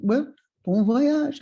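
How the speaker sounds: put-on slow, lilting French-accented farewell. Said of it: "he said to me in a French accent".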